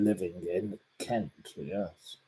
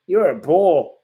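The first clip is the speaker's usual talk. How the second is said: mocking insult; 7 semitones higher, 7 dB louder than usual. Real, not acted. Insulting the troll.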